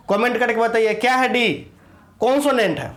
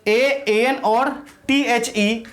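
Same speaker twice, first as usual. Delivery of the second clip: teacher dictating letters to students, slow and clear; slow spelled-out letters; much slower than usual. Shown as a demonstration. Hindi teacher spelling for pupils, slow. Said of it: "directly जानना है".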